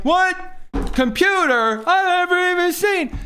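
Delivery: mock clueless kid. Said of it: "they're like".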